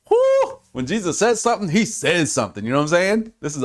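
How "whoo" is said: a high, sudden whoop of delight; excited whoop. Real, not acted. Preacher overjoyed at Jesus' reply, excited whoop. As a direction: let out a thrilled high whoop.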